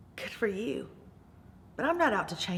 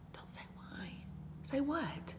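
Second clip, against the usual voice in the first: soft whisper; 3 semitones higher, 14 dB quieter than usual. Retold or imitated. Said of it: "looked at him".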